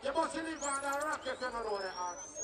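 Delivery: ordinary talk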